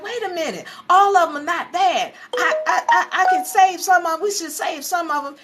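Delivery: high-pitched, stammering plea; imitating someone arguing urgently for others; pleading protest. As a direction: argue back in a high, hurried voice. Retold pleading argument.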